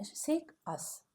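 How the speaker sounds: ordinary delivery, nothing marked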